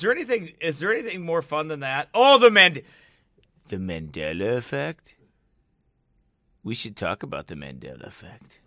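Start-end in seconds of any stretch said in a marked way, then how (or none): 3.7-8.6 low, soft, mock-ominous murmur; hammed-up hushed intrigue; mock conspiracy voice. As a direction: drop down into spooky secrecy. Same mock-ominous voice for another conspiracy.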